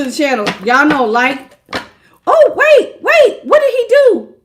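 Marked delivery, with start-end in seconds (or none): 2.3-4.3 shocked. Real, not acted. Reading a shocking chat comment.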